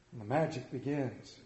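ordinary talk

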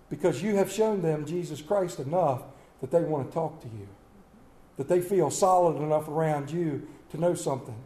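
ordinary delivery

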